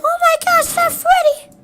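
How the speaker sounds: very high squeaky gasp of surprise; shocked cartoon voice. Telltale seeing Freddy squished, very high squeaky shock. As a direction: squeal it like a toy character seeing his friend. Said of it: "He was like".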